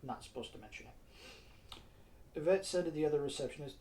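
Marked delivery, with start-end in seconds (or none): 0.0-1.8 soft unvoiced aside, slower; whispered aside. Real, not acted. Narrator breaks off to confide a secret, whispering.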